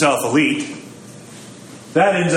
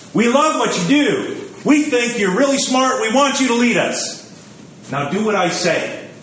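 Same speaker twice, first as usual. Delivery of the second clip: raised, slowed sweet-talk; butter him up, then the demand; congregation flattering the priest; 9 semitones higher than usual. Retold or imitated. Churchgoers flattering their new priest, mock sweet. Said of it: "It goes a lot like this".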